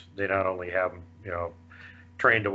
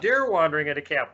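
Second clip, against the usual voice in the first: ordinary delivery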